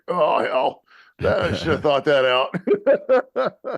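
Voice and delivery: slow, laughing fluster. Retold or imitated. Dad caught out by six-year-old's question, laughing. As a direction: laugh through the embarrassed backpedal.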